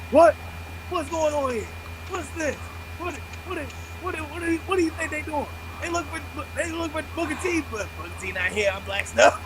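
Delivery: squeaky high auction-caller commentary. Retold or imitated. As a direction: rattle it off like a wrestling auctioneer. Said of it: "dropped back into the auctioneer accent".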